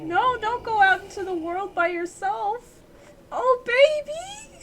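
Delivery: high, tearful-sounding lament; distressed cooing plea. Real, not acted. Player begging a baby creature not to leave, high pitying wail. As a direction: wail tenderly after the little one.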